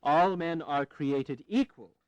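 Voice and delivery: slow, deliberate declaration reading; slow formal reading. Acted. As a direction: pronounce each phrase with care. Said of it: "Strike an independent".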